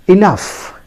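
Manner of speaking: "enough" said ih-NUF; pronunciation demo. Shown as a demonstration. Teacher modelling for learners, "enough" as ih-NUF. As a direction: say "enough" with a final f sound. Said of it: "pronounced as".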